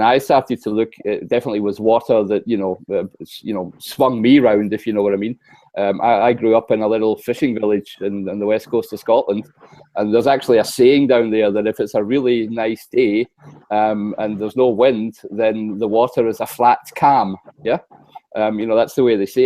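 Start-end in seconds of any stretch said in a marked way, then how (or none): none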